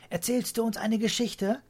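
put-on high, eager child's voice. A narrator's reading. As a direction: ask grandpa like a keen little kid. Children beg grandpa for story.

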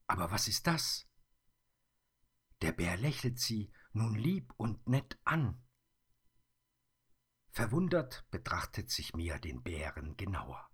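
plain reading voice

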